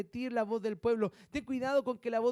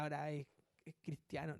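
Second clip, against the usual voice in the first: mock gossip; 5 semitones lower, 9 dB quieter than usual. Mocking what family says.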